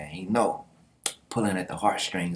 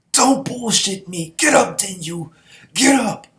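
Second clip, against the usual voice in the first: high, loud, furious denial; hammed-up anguished yell; desperate shout; 12 semitones higher, 9 dB louder than usual. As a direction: yell at him to get up, pitch climbing. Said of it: "And then Nai's like".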